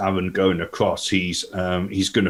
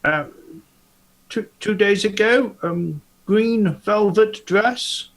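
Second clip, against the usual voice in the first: much higher character voice, eager; 13 semitones higher, slower than usual. Acted. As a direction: pitch it way up, an eager investigator. Same high character voice.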